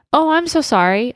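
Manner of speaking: apologizing to the angry guard, mildly flustered; flustered apology. Retold or imitated. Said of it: "So I'm like".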